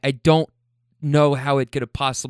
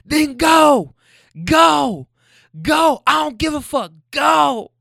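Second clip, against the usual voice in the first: high, loud mock-woman shrieks; imitated screaming; 13 semitones higher, 5 dB louder, slower than usual. Woman screaming at him as the driver pulls away, imitated screaming. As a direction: scream each command after him. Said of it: "I can hear her screaming".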